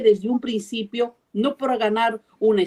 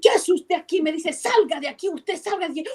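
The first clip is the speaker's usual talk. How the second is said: mocking angry woman; 6 semitones higher than usual. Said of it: "toda enojada".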